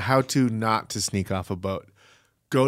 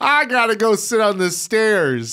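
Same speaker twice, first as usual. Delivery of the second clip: high-pitched pretend tipsy wail; mock drunk whine; 8 semitones higher than usual. Improvised drunk crew member whining.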